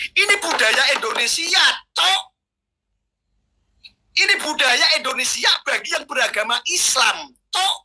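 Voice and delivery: raving like a madman, shouting out; wild unhinged ranting. Real, not acted. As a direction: rant wildly as if possessed. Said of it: "biar ngomongnya kayak orang gila begini".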